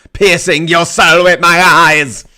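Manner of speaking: loud, slow, menacing boom into the camera; excited. Real, not acted. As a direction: stare the viewer down, booming each word slowly. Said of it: "So I got a bit excited there".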